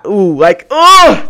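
loud, high "ooh" of frustration; exasperated groan. Real, not acted. Speaker reliving being elbowed, exasperated outburst. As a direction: let the irritation burst out wordlessly. Just talking about it's making me feel.

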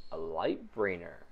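low, soft, slow and knowing; a guarded stranger weighing her words; quiet suspicious woman; mysterious stranger. Acted.

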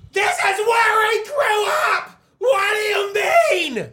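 high, slow, crying shout; mock hysterical yell. Wife wailing at husband about moving, high shrieking yell. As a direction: wail it like a wife losing it over the move. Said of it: "She's just like".

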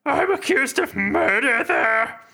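high, slow put-on voice; Sereth voice. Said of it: "these voices are really taking a toll".

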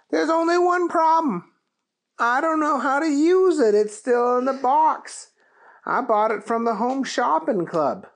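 high, loud folksy voice; sheepish cowboy admitting a problem; Keith voice. Acted.

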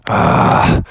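voiceless exasperated sigh; grudging sigh. Acted. Zookeeper agreeing to help the bear, sighing. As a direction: sigh as you give in.

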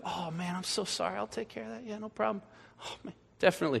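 high, soft, sugary apology; apologetic waiter. Retold or imitated. Waiter sweetly apologizing to the complaining customer, high and soft. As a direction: fawn over the customer, softly and sweetly. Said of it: "And they're like".

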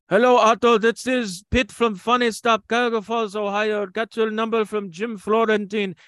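put-on loud, high, slowed, thick Lebanese accent; Lebanese-accented phone call. Club owner phoning ventriloquist, loud Lebanese-accented greeting. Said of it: "so Pete calls and goes".

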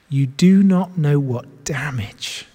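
hushed warning whisper. Real, not acted. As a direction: breathe the warning softly.

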